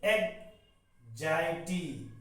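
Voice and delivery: "एग्जाइटी" broken as eg, pause, zai-tee; very slow "एग्जाइटी" split after "eg"; slow pronunciation demo. Shown as a demonstration. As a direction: model "एग्जाइटी" piece by piece. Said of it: "ये इसका pronunciation है".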